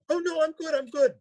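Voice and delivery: high, panicky squeak; nervous refusal. Retold or imitated. Passenger told he is on a cliff, nervous refusal. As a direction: back out in a high mock-scared voice. Retelling his nervous reply.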